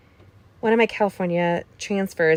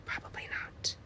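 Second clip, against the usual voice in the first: whispering; 17 dB quieter than usual. Real, not acted. Whispered self-deprecating aside.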